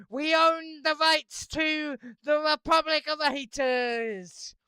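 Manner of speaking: loud, high victory crow with a laugh; cackling, triumphant yell of we own the rights; triumphant gloating shout. Acted. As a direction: rub it in, shouting that you won. Gloating over owning the rights.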